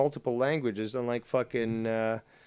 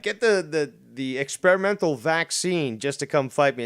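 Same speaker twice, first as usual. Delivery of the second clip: mocking quote; 3 semitones higher, 6 dB louder than usual. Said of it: "Chris is like".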